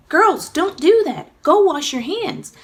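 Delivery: high, loud, slow motherly warning; firm scolding. A narrator's reading. Mommy scolding her daughters, loud and slow. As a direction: stop the girls sharply. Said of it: "Mommy instructed".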